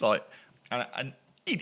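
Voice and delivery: ordinary talk, nothing marked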